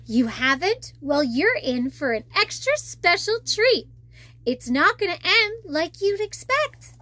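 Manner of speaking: plain reading voice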